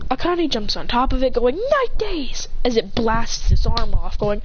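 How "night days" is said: high-pitched attack call; acted anime-style attack cry; battle cry. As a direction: call out a special move. Attack name called out in battle.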